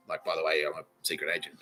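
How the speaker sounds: low, soft aside; mock confiding. Acting out people confiding in him.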